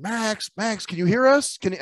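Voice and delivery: high-pitched calling to check the line; mock calling into a silent meeting. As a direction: imitate colleagues calling out, pitched well up. Acting colleagues calling into muted meeting.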